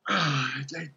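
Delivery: normal talking voice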